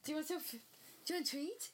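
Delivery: high excited treat voice; talking to a dog. Real, not acted.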